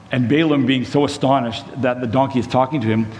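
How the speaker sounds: ordinary talk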